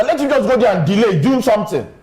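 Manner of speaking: ordinary talk, nothing marked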